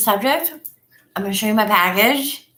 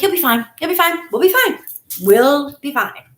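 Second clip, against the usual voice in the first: rising higher and higher, you'll be fine; reassuring pep talk; 8 semitones higher, 3 dB louder than usual. Retold or imitated. To nervous introvert Sherry on the drive, pitch climbing reassurance. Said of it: "I was like".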